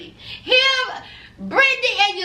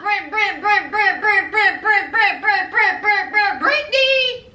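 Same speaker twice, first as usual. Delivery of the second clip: mock sob stammer; 2 semitones higher than usual. Host stammering over Britney's name.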